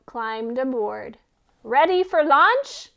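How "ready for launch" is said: loud, high call. Acted. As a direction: call across to the hen. Goat calling out to hen.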